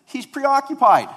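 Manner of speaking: put-on high, loud, slow little-boy voice; imitating son. Son proudly tells his dad the policeman is busy, high loud little-boy voice. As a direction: squeak it out like a proud kid. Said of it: "And Jonathan said".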